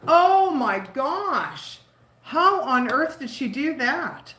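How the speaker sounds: real shock, high and drawn out; shocked dismay. Real, not acted. Learning a friend broke her knee, shocked dismay.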